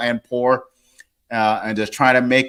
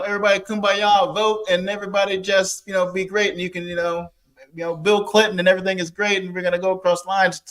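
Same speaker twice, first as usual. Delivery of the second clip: higher, sing-song mocking of feel-good politics; higher, teasing send-up of everyone getting along; mock kumbaya optimism; 6 semitones higher than usual. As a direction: lightly parody the naive hopeful voice. Mocking nineties integration optimism.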